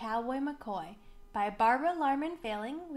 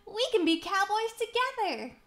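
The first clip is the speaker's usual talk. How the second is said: high, loud, gleeful exclamation; excited storybook cowboy, pitched up; thrilled; 9 semitones higher, 5 dB louder than usual. A narrator's reading. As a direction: bubble over at joining a new pal.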